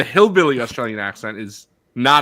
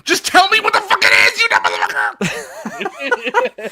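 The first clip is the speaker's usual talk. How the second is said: mock rant; 5 dB louder, much faster than usual.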